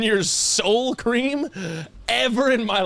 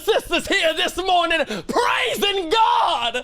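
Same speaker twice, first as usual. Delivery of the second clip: imitated church preacher; 11 semitones higher than usual. Preacher calling out new members to congregation, hammed-up shout. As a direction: belt it out like a revved-up preacher. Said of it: "They said".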